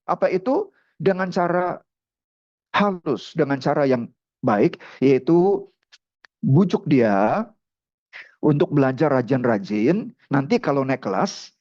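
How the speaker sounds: normal talking voice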